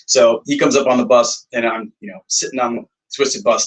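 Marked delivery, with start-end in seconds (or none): none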